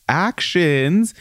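actions lifted with a rising tune; stress on actions. Shown as a demonstration. Reading a quote aloud, marking the italic actions with stress. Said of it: "I said actions like that because they put it in italics".

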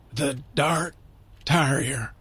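soft, halting Irish tarrier for terrier. A narrator's reading. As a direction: terrier with a broad "a", hushed. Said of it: "He pronounced it like tarrier".

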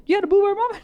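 high, surprised question. Retold or imitated. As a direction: act amazed at the taste. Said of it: "You're like".

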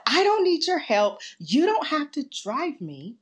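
high, loud retold protest, easing at the end; defensive refusal. Refusing husband's offer of a lift.

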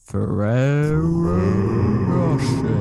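loud, drawn-out dramatic reveal; grand announcement. Acted. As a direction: announce it like a luxury brand.

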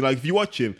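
ordinary talk, nothing marked

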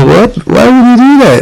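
very high, mock-astonished questioning; incredulous dad. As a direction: imitate a dad squeaking in disbelief. Acting out dad's incredulous reaction.

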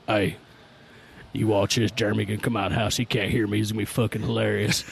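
put-on low country twang for an older brother. Older brother in the tree plotting a prank, country twang. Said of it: "he's got that country twang to him".

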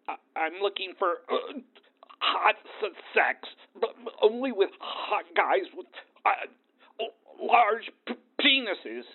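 nervous stammering caller. Retold or imitated.

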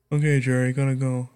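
low, soft mock goodbye; imitated phone sign-off. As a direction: wind the call down in a quiet put-on voice. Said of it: "when Jerry and I end our normal conversations".

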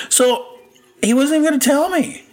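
very high, incredulous squeal of protest; outraged high-pitched complaint; indignant. Real, not acted. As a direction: squeak it out in disbelief. Indignant punchline about her dad.

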